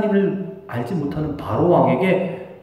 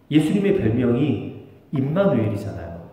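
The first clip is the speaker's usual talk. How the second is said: ordinary talk, nothing marked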